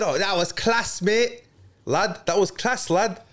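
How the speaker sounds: mock Scouse lad quote; Scouse accent. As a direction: put on a Liverpudlian accent to quote a fighter. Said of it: "most hated accent in, in the world? ... Scouse".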